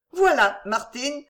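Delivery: ordinary delivery, nothing marked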